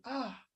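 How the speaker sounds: a long, soft, letting-go breath out; soft release sigh. Shown as a demonstration. Healer showing how to surrender into pain, soft sigh. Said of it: "surrender into that pain".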